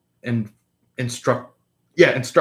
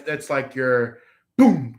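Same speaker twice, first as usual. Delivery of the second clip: normal talking voice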